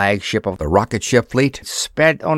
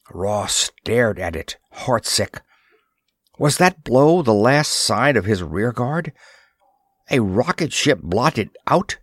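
usual speaking voice

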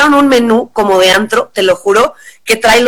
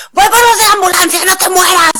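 high, frantic re-enacted cry; imitating herself shrieking in shock; panicked, in shock; 8 semitones higher than usual. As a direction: call out in acted panic. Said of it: "yo estaba como en shock".

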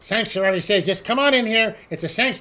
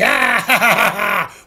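hearty mock pirate laugh, louder; 4 dB louder than usual. Host mocking a prosecutor with a hammed-up pirate laugh. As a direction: ham up a pirate's ha-ha. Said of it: "I'm channeling my inner pirate".

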